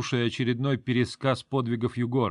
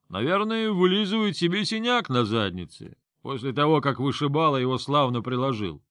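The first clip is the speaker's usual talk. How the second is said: put-on much higher, leering drunken jeer; drunk mockery; 3 semitones higher than usual. Drunk Yugor mocking.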